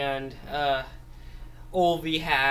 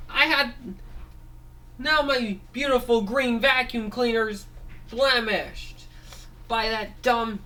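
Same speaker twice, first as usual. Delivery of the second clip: vengeful Ralph figure; 9 semitones higher than usual. Acted. Ralph swears revenge on the alien reptile, high threatening voice. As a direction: vow revenge like a cartoon villain.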